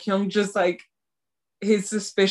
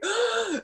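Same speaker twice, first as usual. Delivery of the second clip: high-pitched dramatic gasp; swooning gasp; 4 dB quieter than usual. Acted. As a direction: gasp like a smitten fan. Swooning over his yelling.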